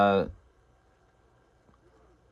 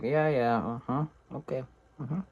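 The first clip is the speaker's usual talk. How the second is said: soft, fading uh-huhs; half-hearted agreement; 4 semitones higher, 8 dB quieter than usual. Retold or imitated. Man humouring a flattering caller, softly noncommittal. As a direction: brush it off, getting quieter.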